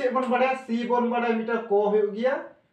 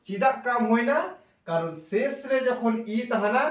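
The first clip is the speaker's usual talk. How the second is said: normal talking voice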